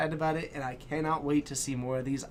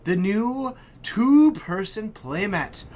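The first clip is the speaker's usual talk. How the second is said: higher, louder, slow announcement; drawn-out reveal voice; grand reveal; 5 semitones higher, 7 dB louder, slower than usual. Real, not acted. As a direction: unveil it like a prize.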